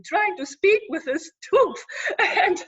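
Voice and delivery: laughing through the words. Real, not acted. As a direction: break into laughter mid-sentence.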